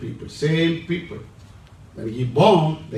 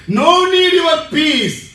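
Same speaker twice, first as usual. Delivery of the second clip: high, loud, slow refusal; mock defiance; 8 semitones higher, 7 dB louder, slower than usual. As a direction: throw back the proud answer.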